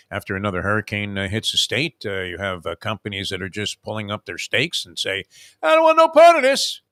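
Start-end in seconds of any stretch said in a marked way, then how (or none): 5.6-6.8 high, very loud backing-out cry; mock refusal yell. Insurance companies pulling up stakes, yelling their refusal. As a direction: yell it like you are bailing out.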